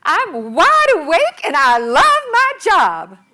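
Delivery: put-on high, loud Southern [name] accent; [name] shout. Shouting at dawn in [name]'s Southern accent. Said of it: "shouted in my best [name] accent".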